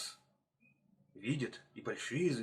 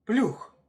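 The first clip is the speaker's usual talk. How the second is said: loud, high burst; one explosive, high-pitched word; sudden splash cry; 9 semitones higher, 8 dB louder than usual. Acted. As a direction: burst out with a startling PLYUKH. Hare recalls sudden frightening splash.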